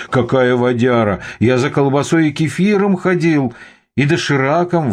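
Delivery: high-pitched indignant protest. A narrator's reading. Guard denies buying vodka, indignant. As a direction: protest the accusation, voice climbing. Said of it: "возмутился охранник".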